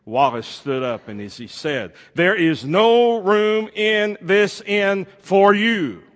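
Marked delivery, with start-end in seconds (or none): 2.1-6.0 loud, high, slow stern proclamation; stage innkeeper refusing word by word, slowly and loudly. A narrator's reading. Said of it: "very sternly, as he'd been directed".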